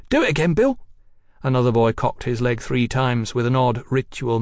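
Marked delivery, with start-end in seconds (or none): 0.1-0.8 put-on high, eager street-boy call; boy's shout. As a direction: egg a mate on in a high boyish voice. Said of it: "one of them shouted".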